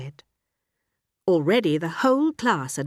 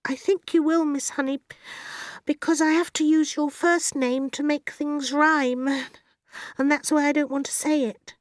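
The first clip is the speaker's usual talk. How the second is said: put-on high child voice, reluctant; high-pitched girl holding back; reluctant little girl; 11 semitones higher than usual. A narrator's reading. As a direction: explain shyly as a polite schoolgirl. Voices Matilda in the story.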